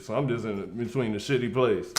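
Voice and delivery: usual speaking voice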